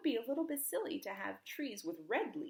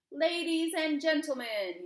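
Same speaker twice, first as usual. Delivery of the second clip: booming royal announcement, higher and louder; king's address; 7 semitones higher, 9 dB louder than usual. Acted. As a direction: call out to the whole square. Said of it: "he said to the crowd".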